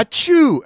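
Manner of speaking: high, sudden mock 'achoo'; fake sneeze. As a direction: act out a sneeze that bursts out. Acts out surgeon's sneeze mid-cut.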